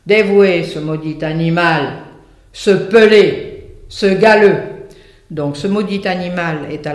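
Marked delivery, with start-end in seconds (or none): 0.0-4.6 angry wolf prosecutor. Acted. The wolf as prosecutor haranguing the court against the donkey, loud and angry. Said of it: "au théâtre on le met, on l'a mis au style direct".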